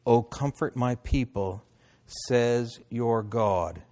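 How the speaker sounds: slow, measured scripture reading; deliberate reading with drawn-out words. Acted. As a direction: read it out solemnly, phrase by phrase. Reads scripture aloud.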